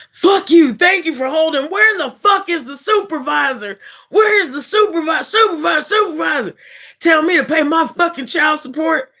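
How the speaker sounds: high screaming rant; furious shout. Retold or imitated. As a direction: scream at the rep, losing it. Caller screaming after long hold.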